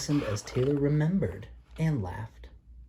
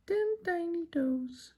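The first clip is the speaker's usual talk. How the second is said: put-on high, slow sing-song for a baby; bright high baby-talk ending; singsong high; slower than usual. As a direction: lift it way up for the little one.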